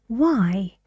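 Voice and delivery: ordinary delivery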